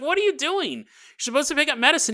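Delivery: high, fast, impatient demand; girl scolding the dawdling doctor, exasperated. Acted. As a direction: rush it out, fed up with him. Bella scolds the slow doctor.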